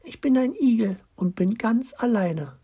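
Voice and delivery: high delicate little voice; small tender creature voice, pitched high; fairy. A narrator's reading. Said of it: "mit zarter Stimme".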